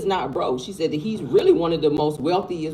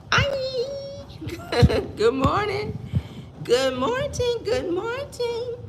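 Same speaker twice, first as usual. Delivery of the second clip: very high squeaky voice; high squeaky greeting; slower than usual. Real, not acted. Greeting viewers on camera.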